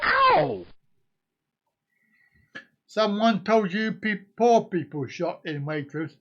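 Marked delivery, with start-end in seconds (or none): 0.0-0.6 surprised yelp. Real, not acted. Sudden yelp.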